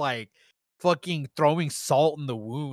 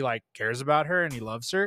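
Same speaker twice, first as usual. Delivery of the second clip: ordinary talk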